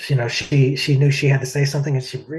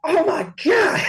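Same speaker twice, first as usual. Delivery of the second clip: starstruck; slower than usual. Retold or imitated. Singer meeting [name], starstruck high shriek. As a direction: gasp it out in disbelief.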